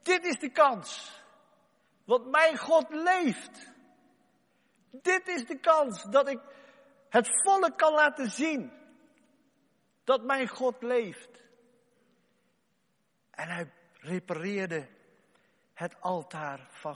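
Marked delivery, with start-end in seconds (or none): 0.0-11.4 slow, loud, high declaration; slow triumphant inner resolve; slow emphatic conviction. Acted. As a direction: hammer out each word of faith. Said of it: "want hij denkt".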